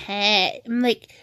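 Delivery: ordinary talk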